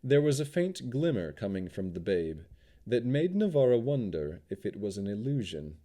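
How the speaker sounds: ordinary delivery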